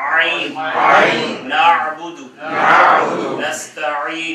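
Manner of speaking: आइन pressed and drawn out slowly before नाअबुदु; throaty ain. Shown as a demonstration. Teacher models Quranic recitation, slow and pressed. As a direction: stretch आइन from the throat, then नाअबुदु नस्तईन. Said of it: "गला खींच रहा है".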